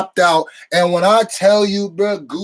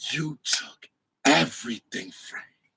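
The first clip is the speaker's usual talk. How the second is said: low, quiet, hateful; 6 semitones lower, 9 dB quieter than usual. Retold or imitated. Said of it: "the way she delivered that line".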